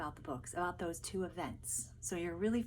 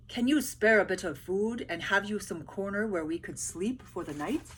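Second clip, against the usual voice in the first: louder, polite request; pleading soldiers; 7 dB louder than usual. Acted. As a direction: ask for food and a corner. Soldiers begging food and bed.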